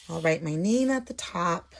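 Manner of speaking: normal talking voice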